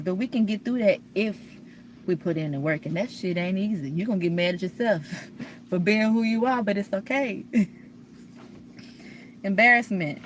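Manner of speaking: ordinary talk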